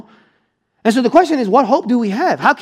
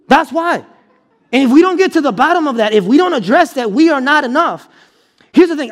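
higher and louder, hammering each line home; preaching at full force; 5 semitones higher, 3 dB louder than usual. Acted. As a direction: preach it out to the congregation with full conviction.

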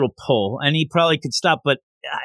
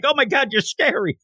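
mock fright; 9 semitones higher than usual. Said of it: "He's like".

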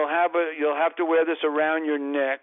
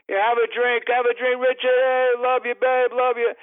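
high, loud, boozy fan calling out in the rain; drunken fan; 9 semitones higher, 4 dB louder than usual. Retold or imitated. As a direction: play a sloppy stadium drunk pushing a drink. Drunk fans offering drinks.

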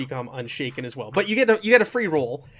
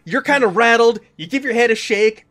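game master narration; 5 semitones higher, 7 dB louder than usual. Acted. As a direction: paint the scene for the players. GM narrates scene.

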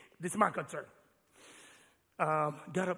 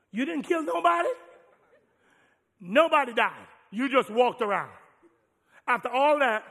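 louder mocking kid voice; disbelieving kid; 4 semitones higher, 10 dB louder than usual. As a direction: scoff like a disappointed son. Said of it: "son's disbelieving reply".

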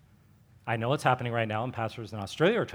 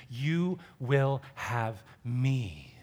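tender promise; much slower than usual. Retold or imitated. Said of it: "The promises are always anchored in".

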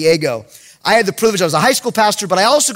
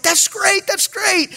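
high, light praise at usual volume; acted encouragement; 11 semitones higher, slower than usual. Youth pastor praising a kid's reading, high-pitched encouragement. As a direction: cheer the kid on in a raised voice.

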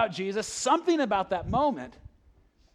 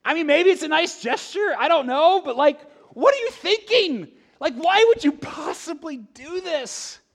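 higher, louder, what-are-you-thinking disbelief; incredulous disbelief; 10 semitones higher, 7 dB louder than usual. Pretend. Preacher voicing listeners at the wasted gift, loud and incredulous. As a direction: raise the voice in amazed disapproval.

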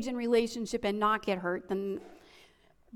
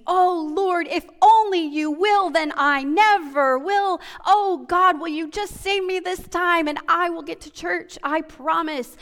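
desperate prayer; 5 semitones higher, 5 dB louder than usual. Retold or imitated. People praying on their knees in crisis.

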